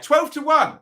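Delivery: twelve jumps high and loud; excited odds call. Real, not acted. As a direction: sell the price with a burst of glee. Announcing long odds.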